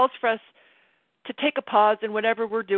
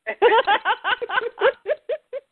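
loud high laugh; 13 semitones higher, 4 dB louder than usual. Real, not acted. Laughing at herself.

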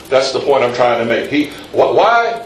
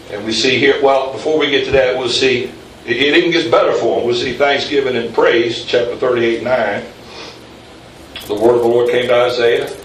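usual speaking voice

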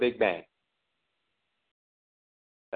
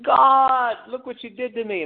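mock accusing wail; 12 semitones higher, slower than usual. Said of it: "guess what they're saying".

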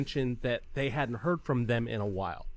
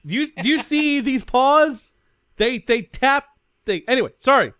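high, loud and fast, laughing at herself; excited protest; 8 semitones higher, 11 dB louder than usual. Real, not acted. Player laughingly denies playing games on her phone, high and loud.

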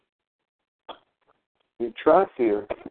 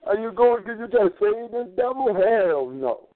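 pitched much higher, insistent and incredulous; retold heated objection; 8 semitones higher than usual. Tyler arguing you can't save the devil, mock-heated and much higher. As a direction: imitate Tyler's pushback, voice climbing high in disbelief. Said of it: "he said".

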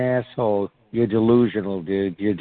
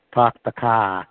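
Boston accent with R-less "Park the car". Shown as a demonstration. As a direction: demonstrate how Bostonians talk. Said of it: "Yeah, they talk that way".

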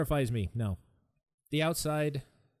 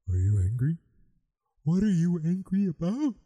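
loud, drawn-out mocking questions; nosy questioner; 4 semitones higher, 5 dB louder than usual. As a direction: imitate someone prodding an angry person. Imitating nosy people asking.

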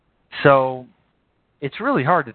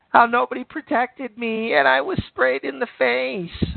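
high mocking whine of a complainer; mock Dickie Spencer whine; 10 semitones higher than usual. Streamer mocking a rival's complaint in a high whiny mock voice. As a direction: whine it out like a sore loser, much higher. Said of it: "bitch and moan and complain".

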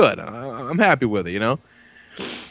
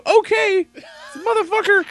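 high-pitched mock outrage; retold indignant outburst. Dad retelling his comeback to his daughter, indignant. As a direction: throw it back, pitched way up in mock offence.

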